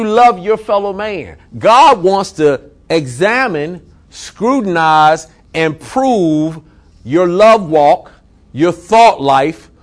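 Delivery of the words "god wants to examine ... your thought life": very slow, each word weighed and drawn out; slow emphatic preaching. Acted. Pastor stressing God's scrutiny, very slow emphatic preaching. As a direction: lay every verb down slowly.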